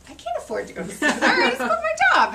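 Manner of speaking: high, loud self-quote with laughter; high retold protest. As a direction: protest in a squeaky voice, half laughing. Acting out own protest to director.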